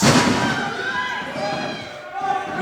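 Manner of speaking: breathless, high, strained disbelief; stunned gasp. Acted. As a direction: gasp it out in shock.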